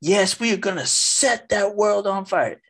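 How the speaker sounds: high, rising preacher's fervour as she builds; mimicking a fiery nun. Retelling the nun's sermon at a conference, high mock fervour. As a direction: ham up the nun stoking the crowd. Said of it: "And she said".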